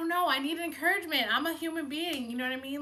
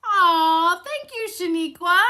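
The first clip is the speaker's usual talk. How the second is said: touched aw; 7 semitones higher, 12 dB louder, slower than usual. Real, not acted. Touched by a viewer's comment.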